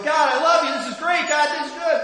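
high, loud gushing praise; gushing fan. Retold or imitated. Preacher mimics fans cheering God, loud and gushing. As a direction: gush like a fan in the stands. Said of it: "we go".

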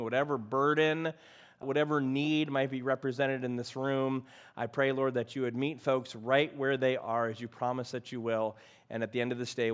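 soft, hushed pastoral prayer. Acted. Pastor prays after reading.

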